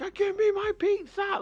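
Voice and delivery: high mock shriek at the locked door; imitating her yelling. Boyfriend imitating girlfriend pounding on Domino's door, high mock yell. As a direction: squeal it like a furious girlfriend. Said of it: "she's, like, yelling".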